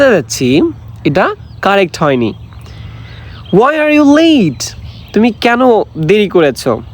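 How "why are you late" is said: much higher, very slow scolding question; slow model English. Shown as a demonstration. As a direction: ask it drawn out, pitched way up. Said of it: "accent দিয়ে দিয়ে পড়বেন". (খুব উঁচু স্বরে ধীরে প্রশ্ন)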